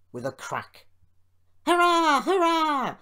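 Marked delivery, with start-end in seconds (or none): 1.6-3.0 very high, loud cheer; long whooping cheer at full voice; roaring. A narrator's reading. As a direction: roar it out like a triumphant rabble. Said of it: "they roared".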